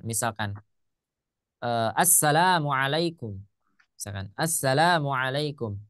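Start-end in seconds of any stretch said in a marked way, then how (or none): 2.0-3.4 "Assalamualaikum" with the la drawn out slowly; long la in greeting. Shown as a demonstration. Teacher showing students the long la in "Assalamualaikum". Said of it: "Kenapa yang lanya saya panjang?". 4.4-5.8 "Assalamualaikum" with the la drawn out slowly; long la in greeting. Shown as a demonstration. Teacher showing students the long la in "Assalamualaikum". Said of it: "Kenapa yang lanya saya panjang?".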